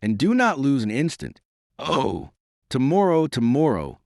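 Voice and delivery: high, eager plea; imploring. A narrator's reading. Nanan begs the Duke to hurry, imploring. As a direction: beg with rising urgency, lightly acted. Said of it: "with a lovely imploring expression".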